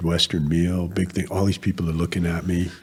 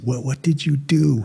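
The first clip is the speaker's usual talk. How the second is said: put-on higher-pitched grandfather with a Portuguese accent; 7 semitones higher than usual. Grandfather questioning his newly graduated grandson, thick Portuguese accent. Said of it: "He had a really thick Portuguese accent".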